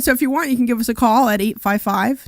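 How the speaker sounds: ordinary talk, nothing marked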